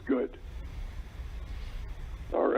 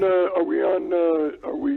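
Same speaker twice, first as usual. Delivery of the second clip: ordinary delivery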